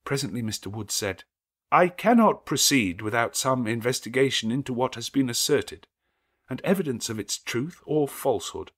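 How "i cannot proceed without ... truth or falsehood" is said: louder, higher, troubled priestly voice; the priest refusing, unsettled; hesitant clergyman. A narrator's reading.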